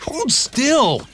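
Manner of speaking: high, urgent donkey order. A narrator's reading. Donkey readying to kick the cat off Shrek, high. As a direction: brace him before the kick. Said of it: "he told Shrek".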